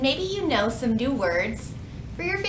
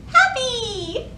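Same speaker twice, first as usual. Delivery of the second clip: much higher, delighted; happy cheer; 11 semitones higher than usual. Acted. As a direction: sing out the word with joy. Revealing happy emoji face.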